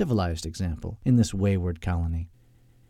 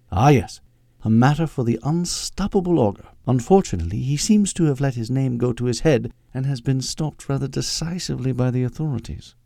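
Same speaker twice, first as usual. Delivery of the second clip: higher-pitched proper British secretary; prim English secretary, knowing; 4 semitones higher than usual. Acted. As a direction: play the unreconstructed Limey, primly informative. Voices Agnes in the story.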